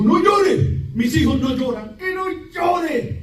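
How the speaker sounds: a father shouting at his crying son to stop; father's harsh order. Retold or imitated. Father yelling at his bleeding son not to cry.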